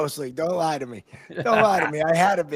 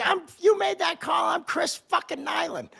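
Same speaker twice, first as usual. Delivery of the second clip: cocky shout; 10 semitones higher than usual. Retold or imitated. Player yelling at the ref, cocky high shout. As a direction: bellow it like a cocky hothead. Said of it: "being a complete dick".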